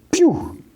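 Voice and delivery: very high "pew" laser noise; imitated blaster shot. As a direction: mouth a sci-fi gun zap.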